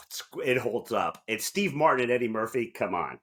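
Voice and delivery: normal talking voice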